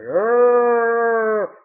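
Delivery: long, loud cultist moan; zombie-like groan. Retold or imitated. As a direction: drone it out like a mindless ghoul. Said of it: "They're like".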